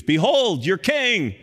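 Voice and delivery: loud, high presentation; proclaiming. Acted. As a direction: announce him to the crowd. Pilate presents Jesus.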